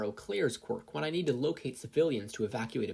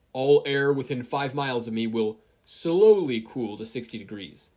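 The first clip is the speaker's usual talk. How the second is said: corrected command; 8 dB louder than usual. Acted. Corrected order, slowly.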